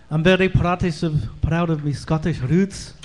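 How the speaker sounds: a light put-on Scottish accent, slow and loud; lightly hammed-up Scottish brogue, slowed down; playful mock Scottish accent for the crowd. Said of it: "I walk around talking like this".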